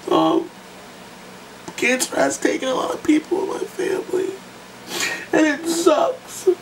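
high, squeaky voice choked by tears; real crying. Real, not acted. Telling viewers about family lost to cancer, sobbing. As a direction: push the words out through sobs, voice giving way. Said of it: "sorry for crying".

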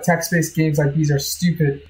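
usual speaking voice

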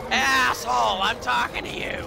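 high loud furious shout. Real, not acted. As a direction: yell it up at the dragon. Yelling at dragon.

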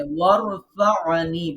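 ওয়ারফা'নি slow with a clear ain after ফা; slow model. Shown as a demonstration. Teacher models the word before the class repeats it. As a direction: say ওয়ারফা'নি slowly for all to repeat. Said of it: "বলেন তো সবাই".

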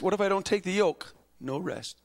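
usual speaking voice

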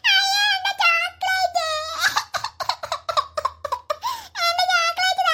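put-on frightened small girl, squeaky and high; childlike wail of fright; high little girl voice. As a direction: play a scared little girl crying for her brother. Child wailing for chocolate.

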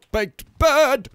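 high, loud exclamation; shouted joke reference. Pretend. Player making a joking reference, loud shout. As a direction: belt it out, pitch jumping up.

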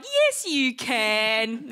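very slow, stretched mock contradiction; drawn-out insisting. Audience silently contradicting the comic, slow mock insistence. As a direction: stretch every word as the doubting crowd. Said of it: "looking at me like".

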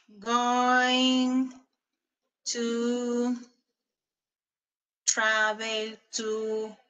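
word by word, long pauses between; slow dictation. Shown as a demonstration. Teacher dictating the question form to students, slow and deliberate. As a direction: spell out the question slowly as you write. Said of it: "Movámoslo, entonces".

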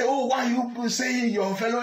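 high, shouted mock of their complaint; mocking quote. Vlogger mocking Igbo critics' complaint, shouted mock whine. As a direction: throw their whining words back at them. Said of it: "Don't say".

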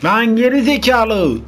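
high, loud, drawn-out insult, slow; angry yell. Real, not acted. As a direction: stretch the insult out in a furious shout. Game death, yelling at screen. (öfkeyle uzatarak bağırma)

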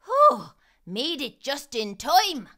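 relieved to reach shelter; relieved whew. Acted. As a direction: puff it out after a dash from the rain. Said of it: "Finnegan announced to himself".

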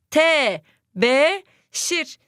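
"Tebeşir" drawn out very slowly, syllable by syllable; syllable-stretched reading. Shown as a demonstration. Teacher stretches the word slowly so pupils can spell it. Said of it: "verilmeyen harfi bulacağız".

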